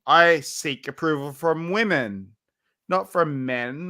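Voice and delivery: normal talking voice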